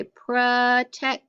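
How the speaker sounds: "protect" drawn out as pruh-tect; schwa. Shown as a demonstration. Teacher repeating the right form for students, schwa "protect". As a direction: slowly say "protect" with uh in the first syllable. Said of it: "can make the schwa sound".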